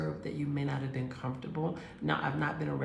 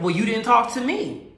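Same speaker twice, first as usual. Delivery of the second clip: loud, higher hurt accusation; accusing boyfriend; 5 semitones higher, 9 dB louder than usual. Retold or imitated. Boyfriend accusing her of ignoring him, loud and hurt. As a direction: throw the blame at her, raised.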